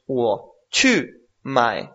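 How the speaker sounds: "wo chu mae" slow, each syllable drawn out with its tone; slow Mandarin model. Shown as a demonstration. Teacher modelling a sentence for a student, slow and clear. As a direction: spell out "wo chu mae" word by word in Mandarin tones. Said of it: "por ejemplo".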